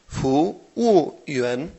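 "Fu wu yuan" in separate stretched syllables; very slow, careful Mandarin tones on "Fu wu yuan"; slow word model. Shown as a demonstration. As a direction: let learners hear each syllable of "Fu wu yuan". Teacher repeats word for learners.

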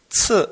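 "zi" with a sharply falling tone; fourth tone. Shown as a demonstration. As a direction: Chinese "zi", falling decisively. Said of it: "cuarto tono".

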